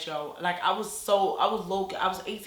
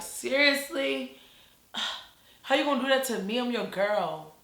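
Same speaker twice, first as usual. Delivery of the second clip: exasperated disbelief; 5 semitones higher than usual. Retold or imitated. Confronting boyfriend in the car.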